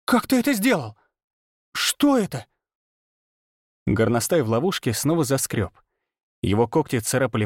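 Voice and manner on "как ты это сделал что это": high-pitched incredulous questions; shrill-pitched, wide-eyed amazement; stunned, nearly choking. A narrator's reading. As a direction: demand an answer in breathless shock. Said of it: "Виола чуть не задохнулась, выпучив глаза".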